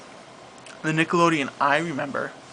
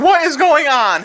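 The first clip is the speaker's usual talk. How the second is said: shocked; 11 semitones higher, 6 dB louder than usual. Retold or imitated. Quoting Andrew shocked.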